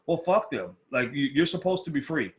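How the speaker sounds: ordinary talk, nothing marked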